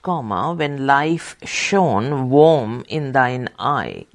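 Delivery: slow, lingering verse reading; poem reading. Acted. Dictating a quoted poem, slow lingering reading. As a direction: draw out the lines like a lament.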